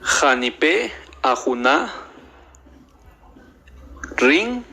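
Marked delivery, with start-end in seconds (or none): none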